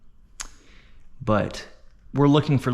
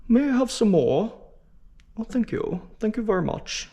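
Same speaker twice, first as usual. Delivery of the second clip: high-pitched, politely begging orphan; Oliver Twist; 8 semitones higher than usual. Acted. Orphan asking for more soup, high put-on voice. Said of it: "Oliver Twist, right? The soup kid".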